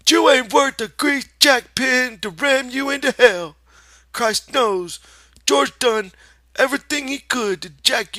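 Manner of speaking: high, loud, mocking rabbit shout; rabbit shouting at Lenny. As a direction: hammer him with a shrill scolding rabbit voice. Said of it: "Lenny said loudly".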